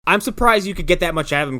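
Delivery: ordinary delivery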